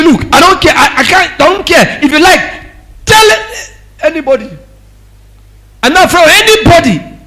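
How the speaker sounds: a husband daring his wife to report him, loud and defiant. Retold or imitated. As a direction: scoff like a man who fears nobody. Defiant husband voiced mockingly.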